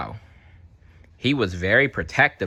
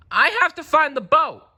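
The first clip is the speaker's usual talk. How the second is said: high, louder urgent plea; desperate Marlin; 5 dB louder than usual. Acted. Marlin begging Dory for help finding the boat. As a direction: blurt it out desperately. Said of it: "said Marlin".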